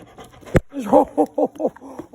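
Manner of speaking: high, rolling ho-ho laugh; whooping laughter at the dog; long, loud hooting laugh. Real, not acted. Playing with the dog.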